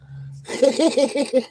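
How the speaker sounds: high, rapid cackle. Real, not acted. Streamer after an over-the-top order, cackling. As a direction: burst out cackling at your own rant.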